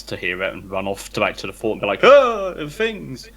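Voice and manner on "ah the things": high squawking skeleton cry. Retold or imitated. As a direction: yelp it like a panicked skeleton. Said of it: "in a skeleton voice".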